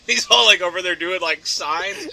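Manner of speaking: usual speaking voice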